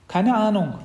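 put-on high, loud child's reply; clueless little sister. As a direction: answer like a carefree little girl. Sister answers brother.

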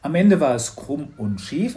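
ordinary delivery

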